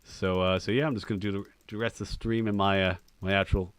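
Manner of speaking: put-on Southern-tinged American accent. Shown as a demonstration. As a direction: play a streamer from America, a bit Southern. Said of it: "Now let's hear your American accent".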